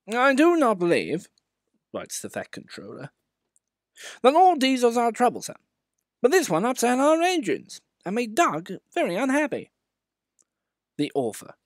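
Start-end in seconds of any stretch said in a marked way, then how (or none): 0.1-1.3 much higher, louder pompous official; Fat Controller. Acted. 4.2-9.7 much higher, louder pompous official; Fat Controller. Acted.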